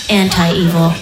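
ordinary talk

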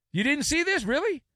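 high incredulous question; surprised. Acted. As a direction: act shocked they missed it. Mock disbelief at listener.

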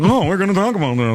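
a light taunting parrot of his words; mocking voice. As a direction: mimic him in a childish tone. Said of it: "mocking him in the mocking voice".

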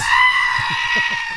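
screaming goat bleat, very high and loud. Shown as a demonstration. Imitating the goats in the stolen car with a screaming bleat. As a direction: let out a shrill goat scream like a human. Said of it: "I think it went like this".

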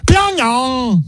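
"Pyongyang" shouted high in a hammed-up Korean way; "Pyongyang" yelled high, each syllable punched; mock scream. Shown as a demonstration. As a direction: belt out "Pyongyang" like a mock announcer. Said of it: "they always scream it when they say it".

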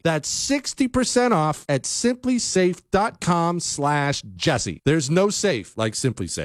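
bright, upbeat host-read sponsor spot. Acted. Radio host reading a sponsor spot to listeners, upbeat ad read. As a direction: sell it like a radio ad.

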